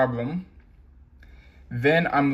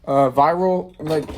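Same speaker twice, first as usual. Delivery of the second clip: normal talking voice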